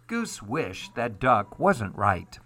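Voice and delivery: ordinary delivery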